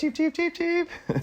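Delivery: very high, soft cheeping; chick imitation. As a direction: peep like a tiny chick. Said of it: "as chicken".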